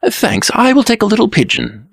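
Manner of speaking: high-pitched dreamy reply; abstracted. A narrator's reading. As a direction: answer absently in a high, light voice. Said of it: "answered the Blue Prince abstractedly".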